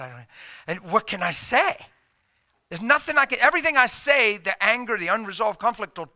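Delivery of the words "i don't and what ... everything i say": ordinary talk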